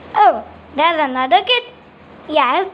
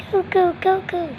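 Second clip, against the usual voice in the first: put-on small, soft, high baby coo; high little baby babble; 4 semitones higher, 4 dB quieter than usual. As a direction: coo like a pretend newborn. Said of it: "Why is it saying cuckoo?".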